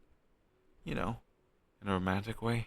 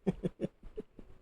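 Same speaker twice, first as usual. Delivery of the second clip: high breathy laugh; 11 semitones higher than usual. Real, not acted. Laughs at the awkward question.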